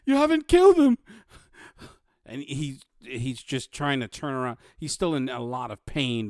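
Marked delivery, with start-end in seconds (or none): 0.0-1.0 high, loud alarm, rising; building panicked cry; panicked guard. Acted. Healed guard warns his rescuers, panicked and loud.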